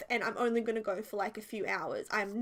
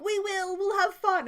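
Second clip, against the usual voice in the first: put-on bubbly teens; 8 semitones higher, 5 dB louder than usual. Teens laughing back.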